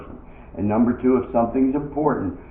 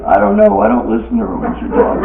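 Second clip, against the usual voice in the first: high-pitched comic wail; mock crying; 11 semitones higher, 5 dB louder than usual. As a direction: whine it out like a tearful husband. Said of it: "Guy sitting in a bar crying".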